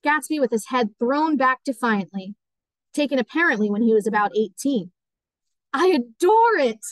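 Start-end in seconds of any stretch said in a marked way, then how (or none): 5.7-6.8 higher, drawn-out gush of delight; delighted exclamation. A narrator's reading. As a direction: exclaim with adoration, stretching it out. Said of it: "exclaimed Daisy".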